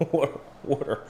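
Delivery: laughing softly through water as wooder; amused, quiet Berks water. Shown as a demonstration. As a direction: chuckle out water twice, wooder style. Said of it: "I used to say water".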